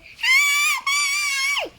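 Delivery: shrieking high and loud for help; very loud scream. Acted. Last townsperson screaming for help, very loud shriek. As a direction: scream at the top of your lungs. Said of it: "Very loud".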